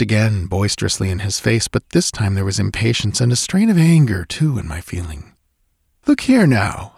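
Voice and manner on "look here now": raised, higher voice calling him to order; cried out. Acted. Narrator cries out at his friend to stop imagining things. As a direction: snap him out of it, voice raised high. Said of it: "I cried".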